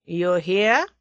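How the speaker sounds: put-on clipped question in a German accent. A narrator's reading. German confronts the captive, German accent. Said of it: "said the German".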